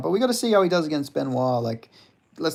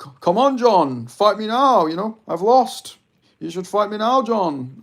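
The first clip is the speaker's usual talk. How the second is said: higher, louder mock goading voice; cocky callout; 8 semitones higher, 6 dB louder than usual. Streamer voicing Tom goading Jones into a fight. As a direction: goad him like a cocky fighter. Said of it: "He's like".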